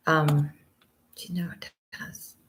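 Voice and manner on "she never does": breathy whisper of disbelief; hushed aside. Shown as a demonstration. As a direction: whisper it to yourself, sadly. Repeats the line, then whispers it.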